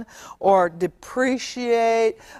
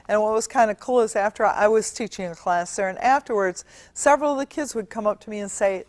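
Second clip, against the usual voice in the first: ordinary delivery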